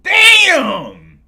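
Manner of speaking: high, loud shout falling away; shocked shout. Real, not acted. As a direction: yell it out in amazement. Shocked reaction to donation.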